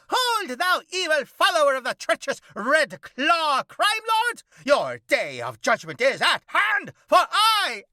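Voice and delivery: high, loud, mock-heroic vigilante voice; hammed-up cockroach hero. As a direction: declaim like a pompous masked avenger.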